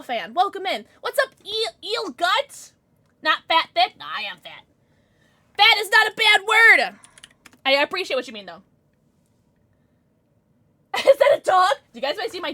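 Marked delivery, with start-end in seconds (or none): 5.6-6.9 high, loud insistence; raised-voice declaration; loud protest. Real, not acted. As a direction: yell it out emphatically. Protesting a chatter's remark.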